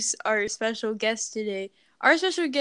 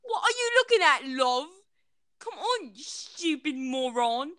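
exaggerated, pitched-up British accent; mock British accent; 2 semitones higher than usual. Chav girl confronting someone, mock British accent. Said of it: "They're, like, British girls".

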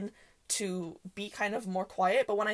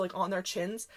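ordinary talk

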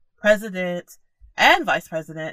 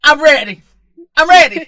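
eager, loud and higher, repeated; can't wait to hear it, bursting; excited; 8 semitones higher, 16 dB louder than usual. Real, not acted. Cohost eagerly urging her friend to do it, excited. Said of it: "tried to speak Spanish to you".

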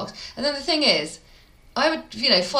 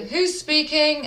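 slow, higher-pitched gatekeeper query; lightly voiced receptionist screening a call; mock receptionist; 8 semitones higher, 3 dB louder, slower than usual. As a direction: draw it out like a receptionist. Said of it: "And they go".

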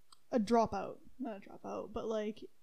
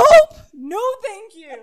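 much louder, higher laughing no; laughing refusal; 8 semitones higher, 16 dB louder than usual. Retold or imitated. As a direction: turn it down, cracking up.

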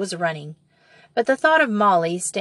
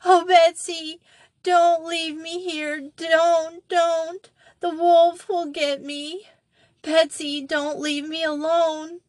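put-on high terrified child begging; a little girl terrified of being left alone; frantic scream; 10 semitones higher than usual. A narrator's reading. Molly begs Betsy not to leave her in the pit, terrified. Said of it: "Molly's cry rose to a frantic scream".

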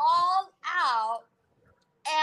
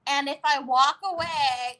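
usual speaking voice

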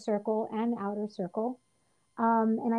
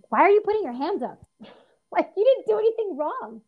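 high, pressing, half-laughing disbelief; can't believe he's raising his hands; incredulous protest; 9 semitones higher, 4 dB louder than usual. Retold or imitated. Girlfriend pleading with her boyfriend, high incredulous protest. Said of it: "I was like".

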